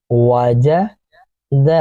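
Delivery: Wajid stretched very slowly, sound by sound. Shown as a demonstration. Teacher demonstrating Wajid for pupils, very slowly stretched. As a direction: drag Wajid out syllable by syllable. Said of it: "اسی طرح سے پھر".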